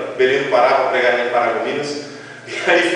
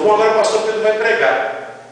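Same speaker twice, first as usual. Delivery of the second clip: higher-pitched imitation of a pastor announcing; imitated announcement; 8 semitones higher than usual. Pastor handing over to the exhausted preacher, imitated announcement. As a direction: announce the preacher, voice lifted high.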